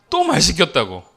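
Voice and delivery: high, loud mock-child protest; complaining child. Crying daughter accusing dad of making her talk, complaining loudly. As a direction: imitate a child's indignant outburst.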